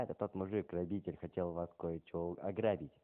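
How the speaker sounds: ordinary delivery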